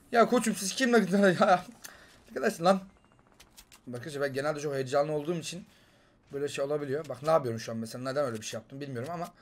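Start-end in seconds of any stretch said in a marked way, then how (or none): none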